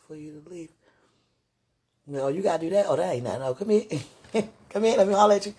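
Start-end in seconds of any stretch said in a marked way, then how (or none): 2.1-5.6 loud laughing call; calling out loud and playful. Real, not acted.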